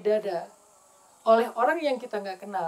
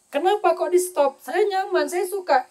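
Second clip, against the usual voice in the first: put-on whiny child; 7 semitones higher, 6 dB louder than usual. Child protests being stopped.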